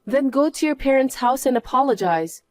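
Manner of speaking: usual speaking voice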